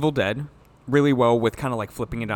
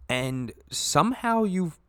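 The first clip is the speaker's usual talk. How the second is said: normal talking voice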